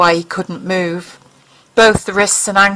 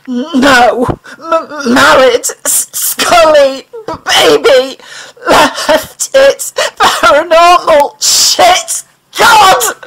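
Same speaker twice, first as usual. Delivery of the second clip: higher and louder, broken sobbing words; falling apart in tears and stammers; incoherent sobbing; 7 semitones higher, 6 dB louder, much slower than usual. A narrator's reading. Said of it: "words poured out, practically incoherent".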